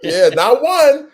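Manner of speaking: high, loud, drawn-out exclamation; excited hype. Real, not acted. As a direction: shout it slowly with delight. Excited greeting of nicknamed arrivals.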